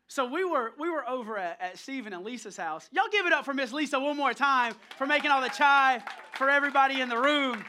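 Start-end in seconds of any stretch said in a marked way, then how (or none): 2.9-7.6 loud, rallying shout-out; rally the room loudly to applaud; calling for applause. Real, not acted. Pastor thanking Miss Lisa before the room, loud shout-out.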